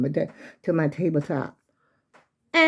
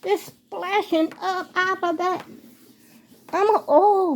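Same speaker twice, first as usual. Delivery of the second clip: panicky, rising alarm at the splashing; real panic as the leak splashes up; 10 semitones higher than usual. Real, not acted. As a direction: voice shooting high in sudden alarm. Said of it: "I'm panicking".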